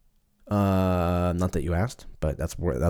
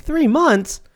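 mock disbelief, pitched high; astonished echo of the doctor; incredulous; 3 dB louder than usual. Mock reaction to doctor's timeline.

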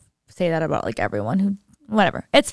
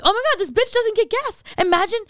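much higher, shocked disbelief; mock incredulous; 13 semitones higher than usual. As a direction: squeal in disbelief at her. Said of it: "I'm like".

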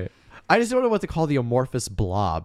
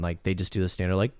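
usual speaking voice

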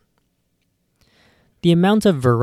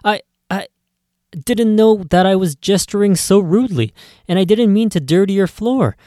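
stammering apology; 8 semitones higher, 4 dB louder than usual. Acted. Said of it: "You stammer and say".

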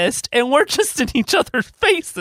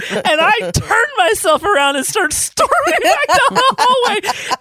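laughing; 9 semitones higher than usual. Real, not acted. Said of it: "laughing while telling".

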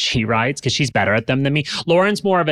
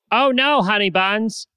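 playful endearment; 10 semitones higher than usual. Real, not acted. Reassuring a worried buyer.

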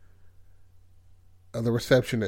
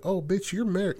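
higher-pitched retold gasp of disbelief; mock surprise; 7 semitones higher, 3 dB quieter than usual. Kristen recognising the receptionist, higher-pitched mock surprise. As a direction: act shocked to recognise her. Spotting an old friend married.

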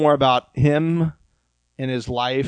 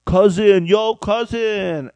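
put-on drunk accent; 7 semitones higher, 6 dB louder, slower than usual. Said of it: "Well, she get drunk, she'd be like".

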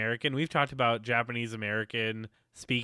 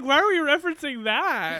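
high, loud incredulous question; mock dismay; 12 semitones higher, 6 dB louder than usual. Host reacting to Alec Baldwin reference, incredulous. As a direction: protest in a scandalized high voice.

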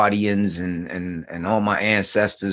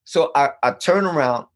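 usual speaking voice

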